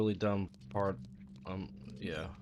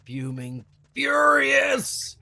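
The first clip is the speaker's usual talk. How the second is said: mock fury; 6 dB louder, much slower than usual. Mock rage joke.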